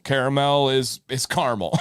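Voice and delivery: first Caramel said KEHR-uh-mel, second caramel said KAR-mul; Caramel in three syllables, then caramel clipped to two; caramel, care-a-mel then car-mel. Shown as a demonstration. Host contrasts the two words for the chat. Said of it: "There are two different things".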